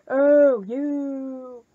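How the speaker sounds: loud, drawn-out exclamation; big, lingering mock reproach; rude-sounding "oh, you". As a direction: stretch it out with exaggerated teasing. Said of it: "I feel really, like, rude saying".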